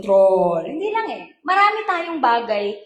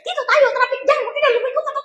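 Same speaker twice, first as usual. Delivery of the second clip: high, shrill-pitched nagging; mock nagging wife in the passenger seat; 11 semitones higher, much faster than usual. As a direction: nag the driver like a bossy wife. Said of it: "bungangera si misis".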